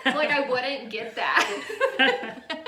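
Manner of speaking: loud laugh running through the words. Real, not acted. Teasing her friend about the satire comment, laughing loudly.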